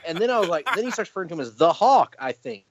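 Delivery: usual speaking voice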